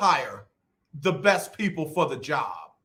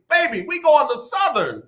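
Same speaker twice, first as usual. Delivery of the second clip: insistent dad; 9 semitones higher than usual. Retold or imitated. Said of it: "I don't have Spelman gear".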